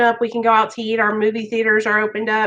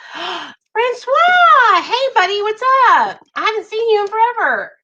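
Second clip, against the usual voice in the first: very high, loud, gasping hello; delighted greeting; 11 semitones higher, 4 dB louder than usual. Real, not acted. Host spotting a viewer unseen in forever, thrilled high greeting. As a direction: squeal hello to a long-lost buddy.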